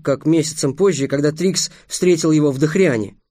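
ordinary delivery

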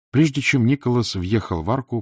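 usual speaking voice

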